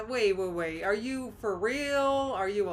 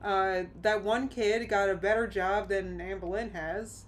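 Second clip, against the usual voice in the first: usual speaking voice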